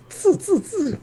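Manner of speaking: high squeaky tsu tsu; squeaky mock noise. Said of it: "It's like".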